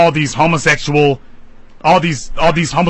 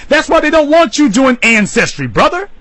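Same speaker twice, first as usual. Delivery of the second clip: rising to a passionate peak; heated appeal to the brother; fired-up preaching; 8 semitones higher than usual. Real, not acted.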